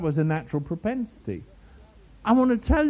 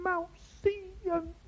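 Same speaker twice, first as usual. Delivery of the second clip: very high, thin monotone; hammed-up quiet praying voice, pitched way up; mock hushed prayer; 14 semitones higher, 5 dB quieter, slower than usual. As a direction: imitate a reverent prayer on one high, small note. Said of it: "they go ever so quiet".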